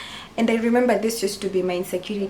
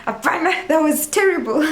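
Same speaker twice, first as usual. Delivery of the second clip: high-pitched, louder mock horror; self-critical dismay; 7 semitones higher, 4 dB louder than usual. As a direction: reject your own performance in exaggerated dismay. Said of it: "I'll just be like".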